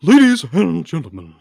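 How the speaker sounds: mock ring announcer opening; announcer intro. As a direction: announce it to the arena. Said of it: "Try and do me one better".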